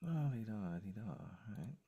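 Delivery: ordinary talk, nothing marked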